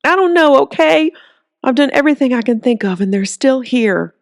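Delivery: he finally cracks under the pressure; breaking down. Acted. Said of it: "something inside him broke".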